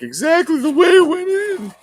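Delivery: high, loud anguished cry about the box; anguished yell. Real, not acted. Collector yelling while opening his mint box in a high, loud cry. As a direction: yelp it out like it hurts.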